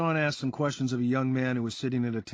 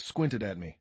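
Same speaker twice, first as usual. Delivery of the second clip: usual speaking voice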